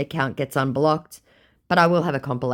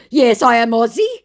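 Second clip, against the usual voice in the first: higher, louder hammed-up insistence; mock scammer insisting; 10 semitones higher, 9 dB louder than usual. Imitating the scammer insisting he is Aussie, loud and high. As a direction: ham up his claim to be Aussie. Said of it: "my scammer goes".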